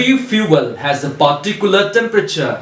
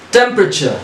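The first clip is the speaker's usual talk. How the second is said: Temperature higher, with the weight on TEM and a falling end; first syllable stressed; 6 semitones higher, faster than usual. Shown as a demonstration. Teacher showing students where Temperature takes its stress. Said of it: "we also stressed on the first".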